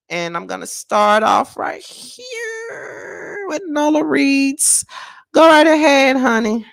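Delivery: high, loud singsong call-in intro; show host announcing. Real, not acted. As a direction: stretch the words out high to bring on a caller. Host announces the first caller.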